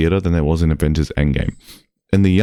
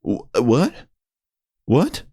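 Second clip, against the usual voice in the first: what rising sharply in disbelief; a stunned, rising what; incredulous what; 6 semitones higher, slower than usual. Real, not acted. Recalling the heckler, stunned and rising what. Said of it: "disbelief at heckler".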